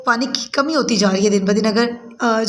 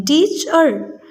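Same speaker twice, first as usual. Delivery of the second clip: "Teacher" broken in two, higher pitched; split into syllables; 4 semitones higher, much slower than usual. Shown as a demonstration. Teacher counts sounds for pupils, "teacher" split in two. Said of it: "हमें टू sounds आ रही हैं".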